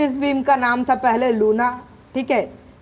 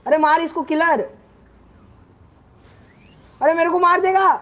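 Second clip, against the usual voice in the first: panicked cry; 6 semitones higher, 4 dB louder than usual. Real, not acted. Panicked gamer about to die.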